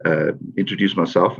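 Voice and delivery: usual speaking voice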